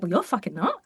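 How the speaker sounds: dry, annoyed comeback; mock indignant retort. Brit retorting to the American, dry indignation. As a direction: snap back under the breath. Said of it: "I'm like".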